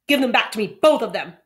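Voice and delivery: loud furious demand; angry demand. Acted. Boy demanding his friend return the toys, furious demand. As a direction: snap it out, loud.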